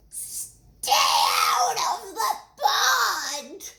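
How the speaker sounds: high, slow, shouted frog yell; drawn-out high shout. Acted. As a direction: bellow it as a bossy frog. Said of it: "yelled Milton".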